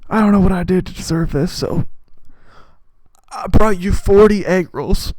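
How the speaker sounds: hammed-up dad handing over a gift; proud dad voice. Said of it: "the man who goes".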